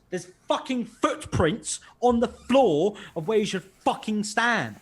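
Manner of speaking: loud, slow, high exasperated rant. Retold or imitated. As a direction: spell it out, fed up. Ranting about Nando's footprints.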